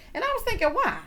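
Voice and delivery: puzzled. Real, not acted. Puzzled reaction.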